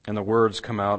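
usual speaking voice

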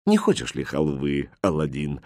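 low, soft, affectionate question; kindly uncle speaking softly; tender offer. A narrator's reading. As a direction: coax the boy gently, voice dropped. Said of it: "ласково спросил он у мальчика".

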